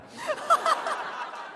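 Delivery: short audience laugh; crowd laughter. Real, not acted. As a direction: crowd chuckles together. Audience reacts.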